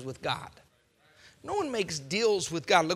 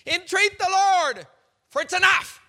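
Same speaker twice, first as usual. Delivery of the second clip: loud, higher begging; loud mock plea; 10 semitones higher, 10 dB louder than usual. Pharaoh begging Moses, loud and higher. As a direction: cry it out like a desperate king.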